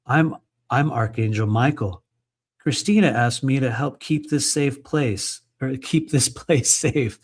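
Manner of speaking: ordinary delivery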